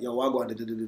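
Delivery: quiet, offhand Jamaican patois; Jamaican accent. Shown as a demonstration. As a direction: greet a cousin casually in a soft Jamaican accent. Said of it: "I had a very strong Jamaican accent".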